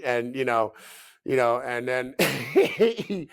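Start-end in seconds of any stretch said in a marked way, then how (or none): none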